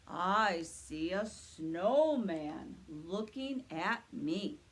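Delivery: slow booming Santa reply. Acted. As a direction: answer slowly like Santa himself. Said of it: "let's ask Santa what he sees".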